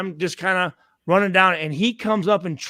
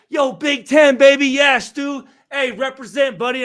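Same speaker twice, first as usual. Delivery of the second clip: hype shout; 5 semitones higher, 6 dB louder than usual. Retold or imitated. Teammate hyping him up.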